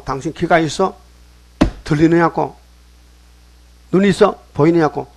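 mildly indignant, insistent questioning; acted challenge. Priests challenging Jesus over noisy children, indignant questioning.

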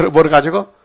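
ordinary talk

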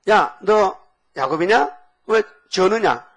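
high, loud, slow demanding; Esau's challenge. Retold or imitated. Esau confronting his brother Jacob, loud and slow. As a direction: draw it out like an angry older brother.

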